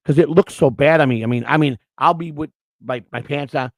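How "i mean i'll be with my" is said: normal talking voice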